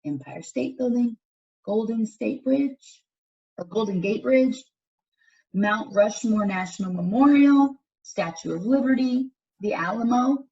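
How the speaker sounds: put-on clear, lively read-aloud for young listeners; reading to children. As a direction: read it out to the class like a picture book. Reads picture book to class.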